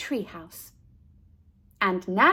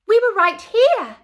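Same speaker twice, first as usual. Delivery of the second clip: high, loud squeal; 11 semitones higher, 10 dB louder than usual. A narrator's reading. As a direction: squeal with delight. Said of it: "Ernestine squealed".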